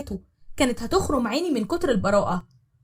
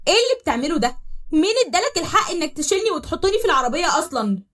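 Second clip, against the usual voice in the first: غضب; 8 semitones higher, 4 dB louder than usual. Acted. بنت غاضبة في العربية.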